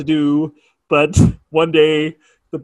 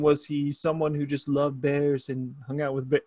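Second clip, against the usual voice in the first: Trump voice; 8 dB quieter than usual. Retold or imitated. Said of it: "why am I doing a Trump voice?".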